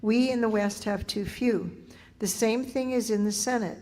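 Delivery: normal talking voice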